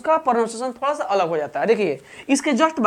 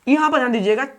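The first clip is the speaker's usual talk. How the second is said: ordinary talk, nothing marked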